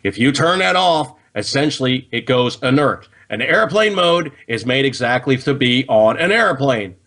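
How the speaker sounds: ordinary talk, nothing marked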